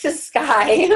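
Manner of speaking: slow, then a high crying laugh; laughing through tears. Real, not acted. Guide laughs and cries leading the class.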